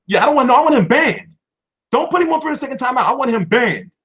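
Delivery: high, loud angry insistence; furious streamer calling for a ban; angry demand. Real, not acted. Angrily demanding a viewer ban.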